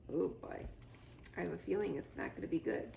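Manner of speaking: quick, soft worried aside; ominous aside. Real, not acted. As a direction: mutter a foreboding comment. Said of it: "reader's own aside".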